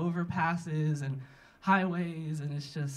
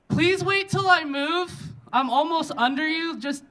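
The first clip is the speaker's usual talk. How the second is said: calling up to someone; 7 semitones higher, 3 dB louder than usual. Acted. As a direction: call up loudly, easing off as you explain. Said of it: "look up at the guy and go".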